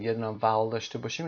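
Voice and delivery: ordinary talk, nothing marked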